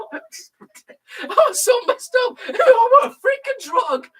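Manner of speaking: high, slow words squeezed out through laughter; laughing hard. Real, not acted. Laughing to his friend about the drunk Obi-Wan, high laughing voice.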